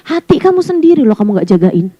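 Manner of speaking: normal talking voice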